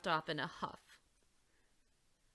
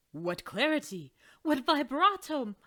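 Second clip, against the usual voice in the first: louder, rising admiring praise; loud excited praise; delighted exclaiming; 6 semitones higher, 9 dB louder than usual. Acted. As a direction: gush over the singing. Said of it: "Maestro Provolone exclaimed".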